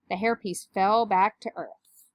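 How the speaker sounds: ordinary delivery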